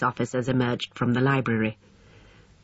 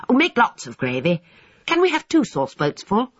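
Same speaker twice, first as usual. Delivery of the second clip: put-on high boy's voice, begging for more; eager schoolboy; 8 semitones higher than usual. A narrator's reading. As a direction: plead excitedly in a high boyish voice. Boys pleading eagerly for gravy.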